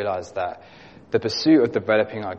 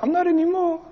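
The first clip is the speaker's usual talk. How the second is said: put-on very high-pitched child voice, a bit louder; little boy's voice; 4 dB louder, faster than usual. Pastor imitating a little boy telling him he's no longer scared, in a very high child voice. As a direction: squeak it out like a small boy proud of himself. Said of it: "I can't really do his high-pitched voice".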